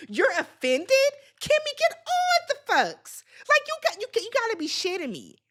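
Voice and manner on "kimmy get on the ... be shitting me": exasperated. Real, not acted. Exasperated at Kimmy.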